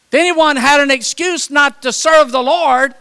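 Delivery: refrain shouted high and loud, serve stressed; full-force preaching. Real, not acted. Preacher thunders the refrain at the congregation, loud and high.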